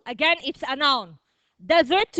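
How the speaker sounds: usual speaking voice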